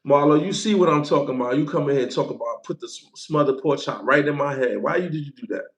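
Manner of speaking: ordinary talk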